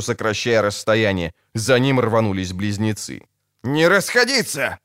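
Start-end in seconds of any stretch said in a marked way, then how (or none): 3.6-4.8 high sharp shout of command; barked order. Acted. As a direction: bark it at the scattering men. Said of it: "рявкнул Киврил".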